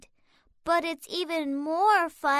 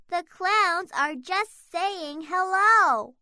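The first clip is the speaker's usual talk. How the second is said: ordinary delivery, nothing marked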